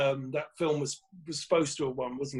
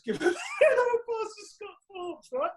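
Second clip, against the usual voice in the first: words breaking into laughter, pitched up; can't get the line out without laughing; laughing through it. Retold or imitated. Retelling his awards speech to friends, laughing mid-announcement.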